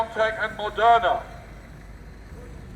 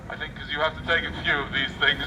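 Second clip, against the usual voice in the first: usual speaking voice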